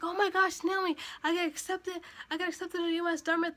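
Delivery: high, loud, tumbling excitement; retold excited scream. Niece tells her aunt she got accepted, excited screaming. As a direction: re-enact yelling the big news.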